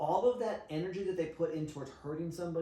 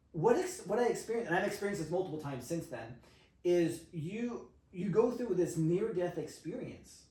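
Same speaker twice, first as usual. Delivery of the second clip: usual speaking voice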